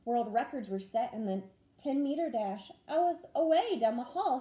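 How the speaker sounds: plain reading voice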